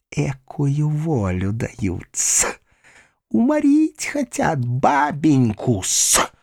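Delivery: high, drawn-out indignant screech; slow, shrill-pitched outrage, stretching the words; spiteful spinster's cry. A narrator's reading. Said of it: "крикнула Перепелицына".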